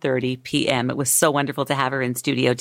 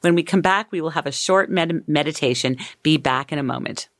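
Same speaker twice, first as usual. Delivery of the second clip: ordinary talk, nothing marked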